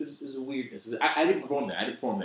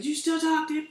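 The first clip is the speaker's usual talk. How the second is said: put-on high girl's voice; 4 dB louder than usual. Girl voiced in retold heckle.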